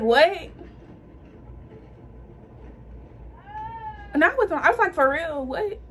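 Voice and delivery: can't believe what she just heard; shocked disbelief. Retold or imitated. To her boyfriend on hearing the news, stunned disbelief. Boyfriend confirms she's pregnant.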